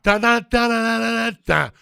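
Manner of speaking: normal talking voice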